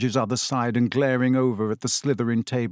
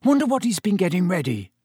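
anxious; 6 semitones higher than usual. A narrator's reading. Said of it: "a note of anxiety in his voice".

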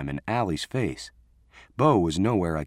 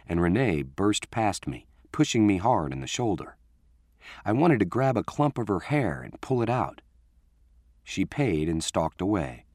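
ordinary delivery

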